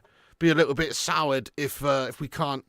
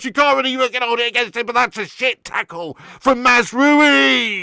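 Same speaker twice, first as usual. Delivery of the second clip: high, very loud wail, gradually easing; anguished shouting; 11 semitones higher, 13 dB louder than usual. Real, not acted. Fan laments Mazraoui's clumsy tackle, anguished shouting. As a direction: howl in despair at your own player.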